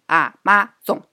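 Amazon in three separate, equally stressed beats; every syllable stressed. Shown as a demonstration. Admitting her own way of saying it, all syllables stressed. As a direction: punch each syllable: A, ma, zon. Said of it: "我是三个都是重音".

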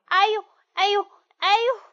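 wolf howling in pain; yelps of pain. Acted. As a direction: cry out as the dog bites. Dog bites wolf's tail.

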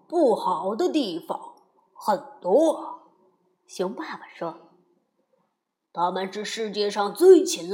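a hint of a disapproving dad; grumpy papa bear.

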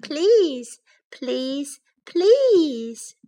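drawn-out begging, first and last pleas soaring. A narrator's reading. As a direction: plead like an insistent child. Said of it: "begged Baby Bear".

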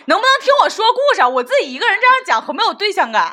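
high, fast and laughing, pleading to be heard; laughing mock plea. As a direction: toss it off quick and giggly like a whiny demand. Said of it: "然后就指着我说".

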